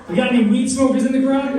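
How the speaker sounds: ordinary talk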